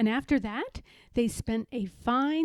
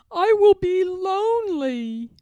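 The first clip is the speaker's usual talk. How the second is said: lonely wail; 7 semitones higher, 8 dB louder than usual. Acted. Toad wailing in the dark.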